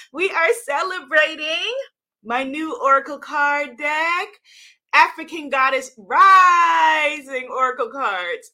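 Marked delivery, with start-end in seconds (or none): 4.9-8.5 loud, slow proclamation with a laugh breaking through; triumphant, drawn-out shout of the name; grand title announcement. Shown as a demonstration. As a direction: belt out the title like a fanfare. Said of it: "that's how you have to say the title".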